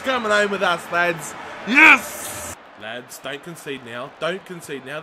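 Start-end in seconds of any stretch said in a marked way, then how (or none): 1.7-2.6 high, loud burst of joy; real celebration; triumphant yell. Real, not acted. Celebrating the goal. 2.8-5.0 low, hushed urging to the team; subdued nervous appeal; anxious plea. Real, not acted.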